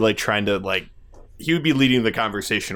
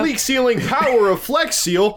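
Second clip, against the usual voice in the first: infomercial pitchman; 10 semitones higher than usual. Retold or imitated. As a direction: shout it like a TV pitchman. Quoting Phil Swift infomercial.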